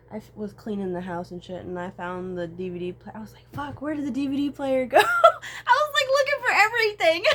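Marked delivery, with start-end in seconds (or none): 3.5-7.4 high, loud laugh-crying through the words; cracking up mid-story. Real, not acted. Vlogger laughing at herself while retelling, laugh-crying.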